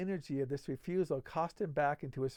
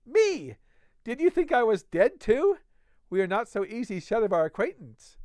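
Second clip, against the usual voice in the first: loud, higher mocking voice of a rough drunk; rough Fetz, mocking; 6 semitones higher, 6 dB louder than usual. A narrator's reading. Fetz taunting McFarlane about being alive, loud scorn.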